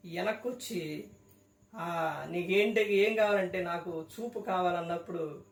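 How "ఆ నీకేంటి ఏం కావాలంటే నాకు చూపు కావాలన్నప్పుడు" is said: gentle voice of Jesus. Retold or imitated. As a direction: quietly grant his wish. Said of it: "యేసుక్రీస్తు ప్రభువారు ఆగి". (మెల్లగా, మృదువుగా)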